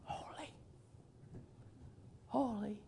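barely audible mock holy; timid faint holy. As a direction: whisper it like a shy angel. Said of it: "no, no, no, no, no".

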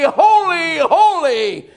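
shouted high holy; full-voiced proclamation of holy; loud seraph cry. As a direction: cry out like angels at the throne. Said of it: "until the decibel so loud that it shook the doorpost".

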